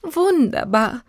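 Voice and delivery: high, drawn-out admiration; enthusiastic. A narrator's reading. Twins applauding the elephant's playing, enthusiastic. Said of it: "klatschten sie begeistert".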